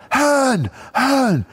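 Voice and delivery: high-pitched mock shout from the stands; high, strained imitation yell; imitated heckler. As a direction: call the surname out like an angry fan. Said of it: "some bloke".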